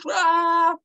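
loud, high drawn-out "ah"; imitated battle yell. Soldier still firing at the bear, loud imitated yell. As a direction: keep yelling as you fire. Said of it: "Falcon's still going".

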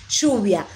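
"lluvia" said as "shuvia"; Buenos Aires "sh". Shown as a demonstration. Streamer gives viewers a porteño example, "lluvia" pronounced "shuvia". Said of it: "que es marcando la ya".

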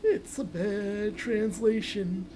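normal talking voice

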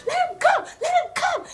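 very high, loud taunting shriek; high loud yell with laughter; shrieking dare. Retold or imitated. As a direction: scream for him to come at you. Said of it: "I'm like".

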